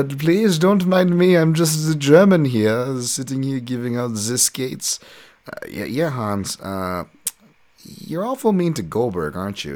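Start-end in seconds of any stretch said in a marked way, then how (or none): 0.0-5.0 high, slow hammed-up German accent. The German coach hands out skates, in a hammed-up German accent. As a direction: play the shady German coach in a mock German accent. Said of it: "with his thick German accent".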